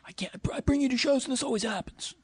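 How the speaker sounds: high, fast, frazzled complaining voice; high-pitched mock exasperation, rushed; exasperated partner. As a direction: whine it fast like a fed-up partner.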